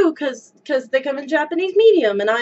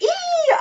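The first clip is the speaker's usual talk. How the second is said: excited squeal; 14 semitones higher, 3 dB quieter than usual. Real, not acted. Squeals over new skirt.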